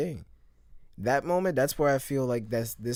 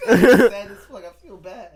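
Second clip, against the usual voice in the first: laughing it out, high and loud; real burst of laughter; 8 semitones higher, 11 dB louder than usual. Real, not acted. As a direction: crack up mid-sentence. Laughing at Greg line.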